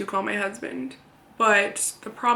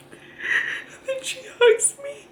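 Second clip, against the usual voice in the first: real sobbing speech, very high and strained; crying; 11 semitones higher, 4 dB louder, slower than usual. Real, not acted. Mother recalling the tech hugging her and crying, in tears. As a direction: force the words out through heavy tears.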